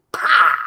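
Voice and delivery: a loud burst of a pyro blast; mouth explosion sound. Acted. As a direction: spit out a big fireworks boom. Band intro explosion sound.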